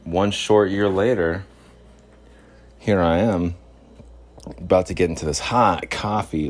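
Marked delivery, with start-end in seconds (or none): none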